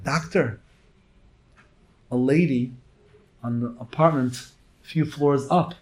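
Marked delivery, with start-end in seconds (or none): none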